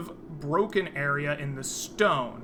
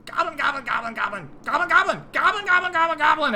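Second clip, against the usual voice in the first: high, squeaky-pitched mock goblin gabble; goblin chatter; 13 semitones higher, 5 dB louder, much faster than usual. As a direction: chatter like an excited goblin horde. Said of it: "they are all chattering in their goblin tongue".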